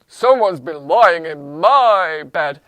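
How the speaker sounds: loud, slow, low papa bear; big bear voice, heavy on MY; deep-voiced Daddy Bear. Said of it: "in his deep voice".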